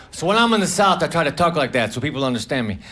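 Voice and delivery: usual speaking voice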